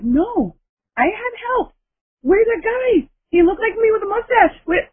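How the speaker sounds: excited high insistence. Retold or imitated. Insisting a stranger helped.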